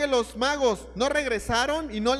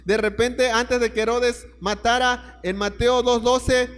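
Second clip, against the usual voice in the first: normal talking voice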